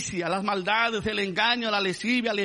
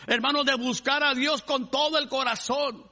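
raised, higher-pitched sermon climax; fervent preaching; 6 semitones higher, 4 dB louder than usual. Real, not acted. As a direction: preach it with full conviction, driving each phrase home.

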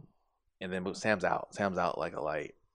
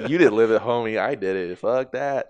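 loud defiant retort; 8 dB louder than usual. Retold or imitated. Defending her pregnancy scare story.

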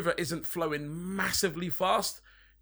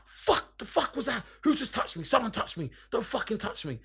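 retold freak-out; 9 semitones higher than usual. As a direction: act out a mate freaking out at a touch. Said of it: "he fucking freaked".

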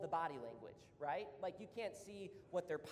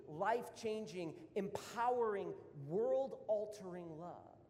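louder, higher, slowing preacher's climax; fervent preaching; 4 semitones higher, 5 dB louder than usual. Acted. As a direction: build the sermon to a powerful peak. Sermon climax.

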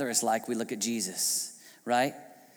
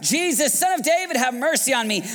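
high, loud cry for mercy; shouted plea; 11 semitones higher, 6 dB louder than usual. Acted. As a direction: call out desperately over a crowd. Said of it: "he began to shout".

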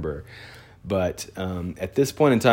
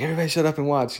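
pitched-up, insistent retold order; mock urgent hushing; 8 semitones higher, much faster than usual. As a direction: imitate a dad calling kids to attention. Retelling dad hushing the room.

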